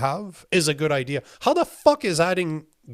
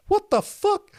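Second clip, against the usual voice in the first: much higher squeak of disbelief; shocked. Real, not acted. Viewer reveals he filed a claim, shocked squeak. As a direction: jolt up in shock.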